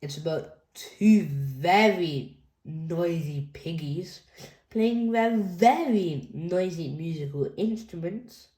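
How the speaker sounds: ordinary delivery, nothing marked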